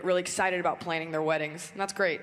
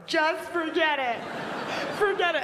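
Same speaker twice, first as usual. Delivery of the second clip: high, slow mock sob of a brush-off; high whiny forget it; huffy girlfriend; 9 semitones higher than usual. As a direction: act the tearful dismissal. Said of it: "I'd be like".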